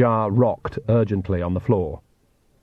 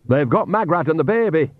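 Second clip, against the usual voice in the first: snapped; 6 semitones higher than usual. Acted. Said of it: "snapped Nanny".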